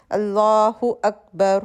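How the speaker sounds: calm prayer-call recitation of Allah Akbar; recited takbir. Shown as a demonstration. Teacher recites the opening takbir of prayer. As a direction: intone it as at the start of prayer. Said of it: "پھر تکبیر".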